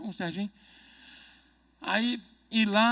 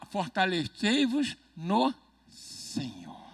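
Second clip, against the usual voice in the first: ordinary talk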